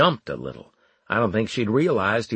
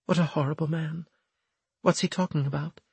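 fearful; 7 semitones higher, faster than usual. Acted. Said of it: "she studied me fearfully".

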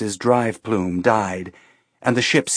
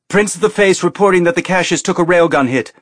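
loud, fast urgent shout; shouted report; 7 semitones higher, 5 dB louder, faster than usual. A narrator's reading. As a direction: shout the damage report over battle noise. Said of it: "Ian shouted".

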